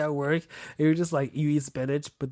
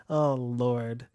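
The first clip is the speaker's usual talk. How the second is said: normal talking voice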